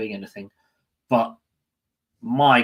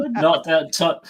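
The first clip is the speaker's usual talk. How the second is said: laughing; 10 semitones higher, slower than usual. Real, not acted. Laughing at a joke.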